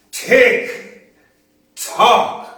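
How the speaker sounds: loud, high tick and tock; poem's closing clock chime, loud and high; clock sound. Acted. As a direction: boom it out like the clock. Imitates clock in poem.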